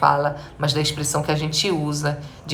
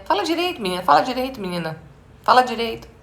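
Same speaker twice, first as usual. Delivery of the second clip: scolding parent; 6 semitones higher, faster than usual. Retold or imitated. Parent to a girl who speaks jumbled, mock scolding. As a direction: nag like a parent correcting a daughter. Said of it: "ou mesmo já fizeram".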